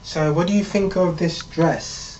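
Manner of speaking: slow come-on in a put-on woman's voice; flirty woman. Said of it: "she gets up and she says".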